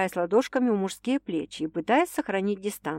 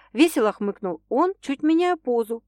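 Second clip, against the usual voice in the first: normal talking voice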